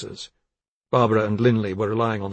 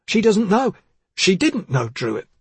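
heated, louder, higher denial; hotly; 9 semitones higher, 6 dB louder, slower than usual. Acted. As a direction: snap back defensively. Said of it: "he said hotly".

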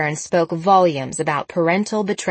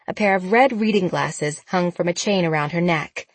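ordinary delivery

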